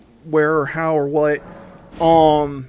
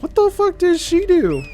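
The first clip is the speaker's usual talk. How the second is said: much higher, disbelieving squeak; baffled outburst. Retold or imitated. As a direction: ask it in shocked disbelief, pitch way up. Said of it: "and I'm like".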